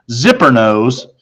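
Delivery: ordinary delivery, nothing marked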